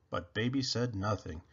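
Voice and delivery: usual speaking voice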